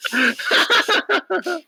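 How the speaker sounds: high bursting laugh; burst of laughter. Real, not acted. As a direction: break into loud laughter. Player laughs at a table joke.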